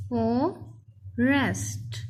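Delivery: Forest as "फॉ", pause, "rest"; split into syllables. Shown as a demonstration. Teacher reading for pupils, forest split in two. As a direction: say forest very slowly in two parts. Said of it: "इसके अलग-अलग".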